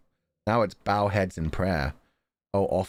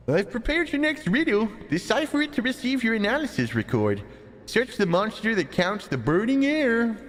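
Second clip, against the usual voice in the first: high, goofy clown voice; silly clown voice. Acted. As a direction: ham up a silly clown riddle. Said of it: "a stupid, like, clown voice".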